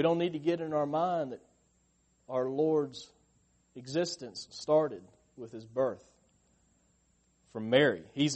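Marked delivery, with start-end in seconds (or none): none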